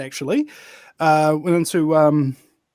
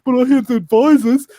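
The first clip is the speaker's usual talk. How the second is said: higher, louder, defensive politician's voice; mock politician; 6 semitones higher, 6 dB louder than usual. Politician asked about his qualifications, mock defensive. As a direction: brush it off like a smug minister.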